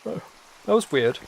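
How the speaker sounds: slow, half-yawned words; drawn out mid-yawn, very slow; through a yawn. Real, not acted. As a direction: let the yawn stretch the line out. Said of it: "I felt the need to s-, um, to yawn".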